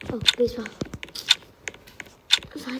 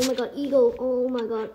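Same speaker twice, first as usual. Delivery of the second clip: ordinary delivery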